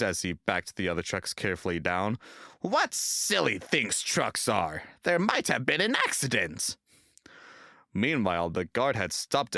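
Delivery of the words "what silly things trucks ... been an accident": scornful. A narrator's reading. James scolding the trucks.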